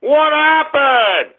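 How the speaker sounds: high, drawn-out mocking wail; taunting, stretched-out cry; mock dismay. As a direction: wail it slowly in fake disappointment. Mocking the man who won't answer.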